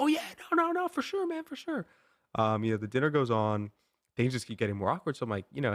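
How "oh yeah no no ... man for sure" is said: much higher, squeaky mock reassurance; a cartoonishly high falsetto brush-off; mocking buddy's reassurance. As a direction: parody a friend dodging the question. Mimics buddy's evasive reassurance.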